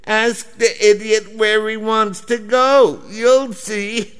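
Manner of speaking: high, thin mock squeak of the clerk; squeaky little voice. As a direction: pipe up tiny and high from the back. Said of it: "the woman's squeaky little voice".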